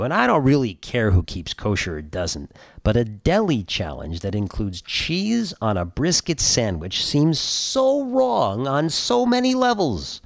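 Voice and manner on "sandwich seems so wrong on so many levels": mock outrage. Mock-outraged rant about treyf food.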